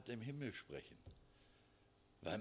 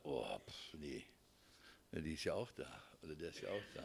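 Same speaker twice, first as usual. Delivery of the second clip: mock grumbling; 6 semitones lower, 5 dB quieter than usual. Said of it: "und würde sagen".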